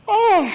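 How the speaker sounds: loud, high yawning "oh"; hammed-up yawn that falls away; staged yawn. Camp host short of sleep, loud staged yawn. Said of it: "every time I yawned".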